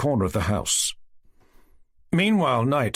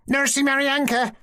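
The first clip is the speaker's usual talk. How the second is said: put-on little boy shouting; 13 semitones higher than usual. A narrator's reading. Little brother calling his sister in for supper, high child's shout. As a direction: call her home like a small boy. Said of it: "shouted Maryanka's little brother".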